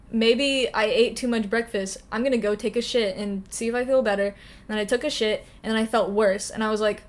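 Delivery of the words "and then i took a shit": ordinary talk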